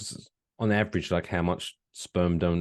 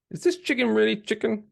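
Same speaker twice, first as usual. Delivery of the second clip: incredulous; 14 semitones higher than usual. Retold or imitated. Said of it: "when people were like".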